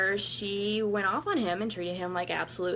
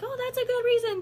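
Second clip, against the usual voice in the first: much higher, sing-song mock of polite society; mock approval; 3 dB louder, faster than usual. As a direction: parody the socially correct answer.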